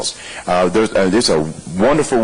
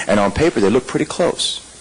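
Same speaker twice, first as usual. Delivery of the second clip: ordinary delivery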